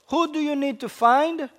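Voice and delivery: ordinary talk, nothing marked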